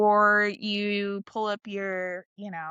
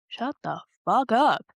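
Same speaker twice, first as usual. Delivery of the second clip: slow, emphatic command; drawn-out, no-nonsense put-down; firm telling-off; slower than usual. Acted. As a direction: shut down the nagging inner voice, word by word. Telling off the inner voice.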